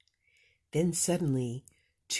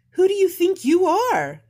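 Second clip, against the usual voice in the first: high, loud indignant cry; haughty cry; 12 dB louder than usual. Acted. Rainbow Fish snapping at the little blue fish, loud. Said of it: "cried the rainbow fish".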